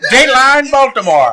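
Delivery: booming newsreel headline; loud vintage news opener; old radio announcer. Pretend. Joins the announcer bit.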